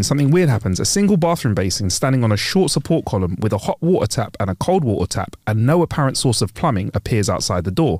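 normal talking voice

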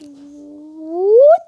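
rising loud whoosh; whoosh sound. Real, not acted. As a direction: swoosh the character across.

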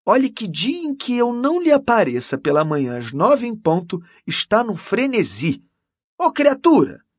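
high, loud, animated storytelling; animated boasting. A narrator's reading. Canon telling Mendes of her devotion, animated. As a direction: gush about it, pitched well up.

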